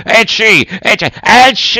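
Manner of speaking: three high theatrical sneezes; high mock sneezing fit; acted sneezes. A narrator's reading. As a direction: sneeze in character, the last one biggest. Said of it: "fece altri tre starnuti".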